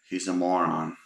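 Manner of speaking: deadpan and quiet; dry, level verdict; flat, unmoving tone. Retold or imitated. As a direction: say it flat, without any movement. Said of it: "in a flat, unmoving tone".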